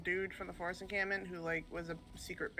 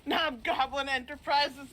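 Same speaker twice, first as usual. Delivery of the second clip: high, loud, slow gleeful question; delighted disbelief; 8 semitones higher, 7 dB louder, slower than usual. Real, not acted. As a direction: savour each word in amused shock. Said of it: "Oh my God".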